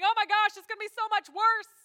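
hammed-up Israelite panic; mock panic. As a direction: act out the crowd losing it. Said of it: "they're panicking".

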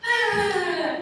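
long, high, loud "ah"; imitated child squeal. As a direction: mimic a child squealing mid-leap.

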